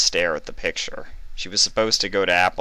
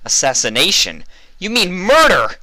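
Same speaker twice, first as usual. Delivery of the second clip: a much higher, loud furious yell; angry shout; 13 semitones higher, 9 dB louder than usual. A narrator's reading. Vinyl yelling at the hidden interrogator, furious. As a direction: shout it in outrage. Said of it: "Vinyl shouted angrily".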